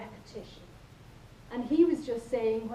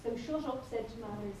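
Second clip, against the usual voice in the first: ordinary talk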